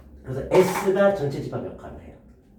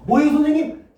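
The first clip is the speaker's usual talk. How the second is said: higher, louder student question; lightly voiced student; 10 semitones higher, 6 dB louder than usual. Teacher playing a student asking the teacher, lightly mimicked.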